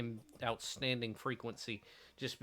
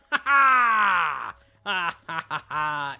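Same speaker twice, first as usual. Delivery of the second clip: loud hammed-up villain cackle; evil genius laugh; 4 semitones higher, 13 dB louder, much slower than usual. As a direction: cackle like a triumphant mad genius. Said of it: "Evil genius laugh".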